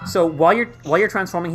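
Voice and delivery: ordinary delivery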